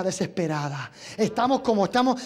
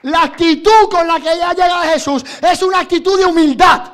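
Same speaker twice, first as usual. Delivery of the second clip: loud, raised-pitch sermon shout; full-force preaching; 7 semitones higher, 13 dB louder than usual. Acted. Preacher rallying the congregation tonight, full-force preaching.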